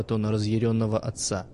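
usual speaking voice

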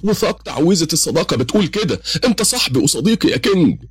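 high-pitched, heated protest; angry outcry. Acted. Adam protests the devil's broken pact, angry and high. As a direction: cry out indignantly, voice climbing high. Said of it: "صرخ آدم بغضب".

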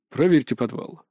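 composed baritone giving an order; director's order. A narrator's reading. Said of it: "велел тем временем директор".

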